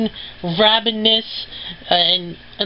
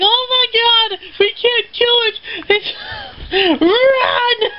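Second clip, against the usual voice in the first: much higher, crying shout breaking into a laugh-sob; hysterical scream. Retold or imitated. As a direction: shriek it in total panic. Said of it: "It's like".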